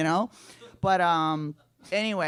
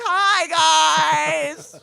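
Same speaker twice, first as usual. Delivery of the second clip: very high, loud shouted hello; gleeful mock-drunk yell; drunken greeting; 13 semitones higher, 11 dB louder than usual. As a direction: holler across the street, hammed-up and oblivious. Drunk calling out to meeting.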